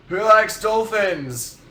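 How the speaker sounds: high, drawn-out mock stage call; bad pop-star impression, hollering to the crowd. Singer asking a concert crowd, hammed-up holler. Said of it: "that's my terrible Harry impression".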